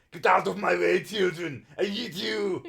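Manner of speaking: loud, high Austrian action-hero bark; Arnold Schwarzenegger impression. Streamer imitating a critic's Austrian Arnold, loud bark. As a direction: bellow it like movie-star Arnold. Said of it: "his, uh, Arnold Schwarzenegger".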